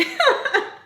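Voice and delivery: sudden high real laugh; high burst of laughter. Real, not acted. Laughing at her own attitude.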